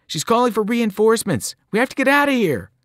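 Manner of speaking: high, fast, rising urgency; urgent alarm. A narrator's reading. Annabeth warning that reinforcements are coming, fast high urgent. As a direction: push them to flee now. Fleeing the Fury.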